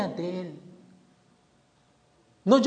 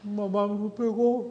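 put-on small tearful child's voice, slow; whimpering child; 4 dB quieter, slower than usual. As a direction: play the crying son. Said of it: "¿Por qué estás llorando?". (hijo que llora, voz infantil y lenta)

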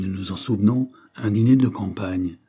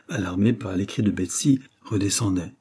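usual speaking voice

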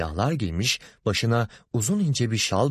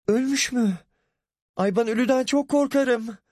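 frightened. A narrator's reading. Ahu frightened of the dead.

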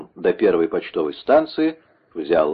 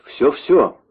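high, eager cry; eager exclamation; 7 semitones higher, 4 dB louder than usual. A narrator's reading. Executioner promising the monk the whole truth, eager exclamation. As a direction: exclaim it urgently, pitched up. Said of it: "воскликнул палач".

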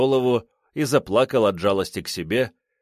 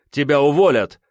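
louder angry threat; threatening shout; 5 semitones higher, 6 dB louder than usual. A narrator's reading. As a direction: yell the threat. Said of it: "пригрозил он".

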